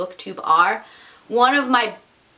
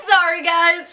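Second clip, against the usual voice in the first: laughing; 8 semitones higher than usual. Real, not acted. After the failed challenge.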